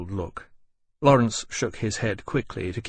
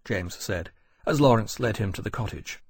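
ordinary delivery

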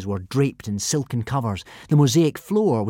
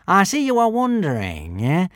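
nasty smile; 7 semitones higher, 3 dB louder than usual. A narrator's reading. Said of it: "smiled Alvin nastily".

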